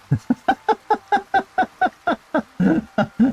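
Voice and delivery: long, high run of laughter; amused laugh. Real, not acted. Player amused at how costly fun is, laughing. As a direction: laugh in a steady string of high bursts.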